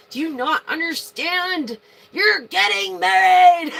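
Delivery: high, loud, thrilled yell; excited shout. Real, not acted. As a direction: shout the big news with joy. Announcing marriage in tarot reading.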